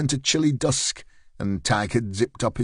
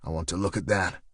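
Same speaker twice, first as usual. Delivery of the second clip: flatly; 5 semitones lower, 6 dB quieter than usual. Acted. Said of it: "said Stryker flatly".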